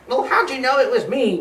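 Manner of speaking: high, whiny mock moan; moaning turkey. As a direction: complain like a caught turkey. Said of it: "moaned the turkey".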